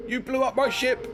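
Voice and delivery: high outraged squeal; shocked and indignant, up high; indignant outburst. Acted. Player character outraged at NPC.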